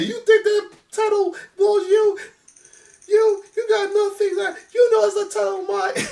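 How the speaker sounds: high, hammed-up wrestler shout; mimicking Bret's rant. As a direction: squeal it out like an outraged champion. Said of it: "Brett said".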